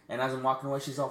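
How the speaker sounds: ordinary talk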